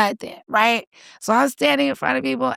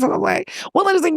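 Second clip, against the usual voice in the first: ordinary delivery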